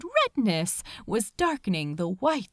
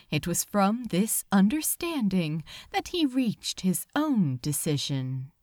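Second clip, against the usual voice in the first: ordinary delivery, nothing marked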